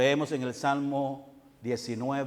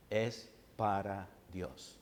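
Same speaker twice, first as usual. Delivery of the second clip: slow, much softer reverent answer; hushed reverent answer; 7 semitones lower, 11 dB quieter, slower than usual. Acted. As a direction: drop the voice and slow right down. (respuesta lenta y muy suave)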